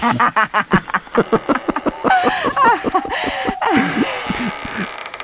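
high, long evil laugh; evil cackle. Acted. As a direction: cackle over the victim. Villainess gloats over hanged victim.

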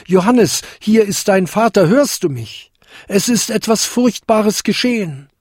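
höher und lauter hinabgerufen, dringlich; rufend. A narrator's reading. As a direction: ruf verzweifelt nach unten. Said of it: "rief er". (Vater ruft dem Sohn nach unten zu, höher und lauter)

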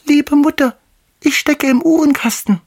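put-on very high, tiny kid-goat voice; fine little voice. A narrator's reading. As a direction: littlest kid piping up from hiding. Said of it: "antwortete eine feine Stimme". (feines, hohes Geißleinstimmchen)

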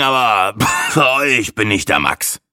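braggart talking through a cough. A narrator's reading. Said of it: "hustete der Angeber". (hustend, angeberisch)

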